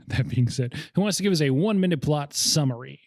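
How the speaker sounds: normal talking voice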